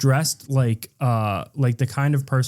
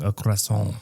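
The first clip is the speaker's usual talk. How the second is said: softer, put-on French accent; 2 semitones lower, 5 dB quieter than usual. Shown as a demonstration. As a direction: practise your French accent. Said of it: "I'm doing French".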